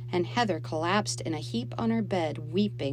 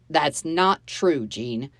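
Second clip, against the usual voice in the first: louder, slow, firm objection; mildly raised voice of a husband; voice rising; 6 dB louder, much slower than usual. A narrator's reading. Husband contradicting his wife in an argument, voice raised. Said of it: "Dave's voice rose".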